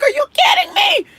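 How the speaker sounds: retold shout at the price of a lighter; loud high mock yell; outraged shout.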